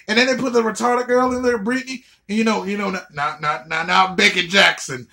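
normal talking voice

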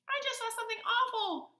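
very high, quick little mouse voice; crying mouse. A narrator's reading. Mouse warns Frog of something awful, high alarmed cry. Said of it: "cried the mouse".

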